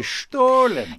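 Stollen said as "SHTOH-len"; High German name. Shown as a demonstration. Host offering his own version: Stollen as "SHTOH-len". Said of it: "I was gonna say".

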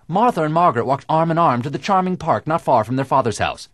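loud, high, rounded vowels; diction exercise. Shown as a demonstration. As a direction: project it like a stage actor warming up. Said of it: "an acting exercise for diction".

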